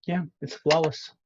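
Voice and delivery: very soft, self-satisfied; hushed smug boast. Real, not acted. Streamer praising his own Spanish, hushed smug boast. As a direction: murmur it like a private victory. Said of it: "I know you're probably thinking".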